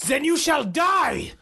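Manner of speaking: high, slow shouted threat; screamed. Retold or imitated. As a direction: scream it like a killer. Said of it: "Kürten screamed".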